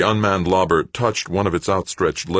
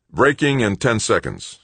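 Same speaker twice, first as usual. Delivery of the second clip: tense copilot; 7 semitones higher, 4 dB louder than usual. A narrator's reading. Killefer reading flight plan.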